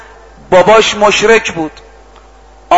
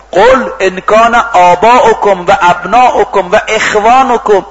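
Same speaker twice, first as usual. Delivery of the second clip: higher-pitched verse recitation; Quran recitation; 5 semitones higher than usual. Real, not acted. (تلاوت آیه با صدای زیرتر)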